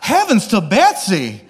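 slow, hammed-up Southern belle exclamation. Preacher joking about Peter, in Southern belle voice. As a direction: play a flustered Southern belle for laughs. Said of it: "picture it like he's a Southern belle".

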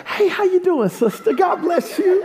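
high, sing-song fake cheer; put-on pastor greeting. Pastor greeting a sister after rolling his eyes, put-on cheer. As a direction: greet with hammed-up sweetness.

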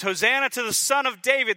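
high, loud jubilant cry; hammed-up crowd acclaim; crowd shouting. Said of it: "The crowd shouts accolades".

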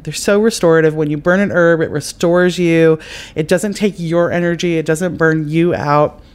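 ordinary talk

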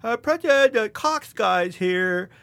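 high, loud put-on mom voice; mom's voice. Son imitates mom fetching him for the Cox guy, put-on mom voice. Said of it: "like, Mom answered the door".

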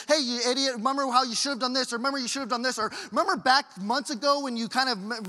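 mock scolding Jesus lecturing sinking Peter. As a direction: nag him like a disappointed parent. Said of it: "starts lecturing him".

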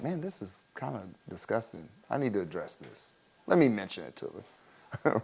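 soft, muttered mock Eli; muttered aside. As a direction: grumble it under your breath as old Eli. Eli's inner grumble.

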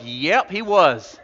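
slow, loud drawn-out yep of agreement; congregation agreeing. Retold or imitated. Preacher voicing the congregation's loud, slow agreement. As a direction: stretch it out like a whole church nodding along. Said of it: "They're all like".

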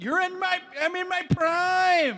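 high, slow mock proclamation; mock triumphant voice. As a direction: belt it high like a diva. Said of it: "you shouldn't feel like".

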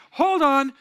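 high, loud mock interruption; retold aunt halting the story; abrupt objection. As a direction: cut in sharply as the aunt. Aunt cuts into mom's story.

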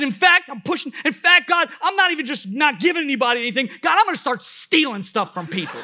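loud, high-pitched mock tantrum at God; comically furious outburst, easing a little at the end; mad, arguing. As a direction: escalate into an absurd, indignant threat. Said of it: "I'm mad".

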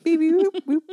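high, loud beep-boop robot; toy robot voice. Pretend. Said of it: "give me your best robot noise".

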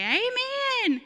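high, loud, stretched-out reply; crowd's amen shout. Retold or imitated. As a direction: imitate a crew yelling back in response. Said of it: "our people would say".

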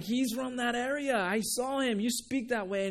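put-on single him out by his accent; accusing bystander. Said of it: "And it's like".

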